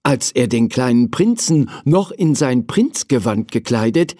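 plain reading voice

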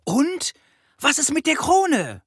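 high, loud, eager question; squeaky courtiers demanding an answer; questioning crowd. A narrator's reading. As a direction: press the king in a high-pitched voice. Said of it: "Alle starrten den Löwen an und fragten".

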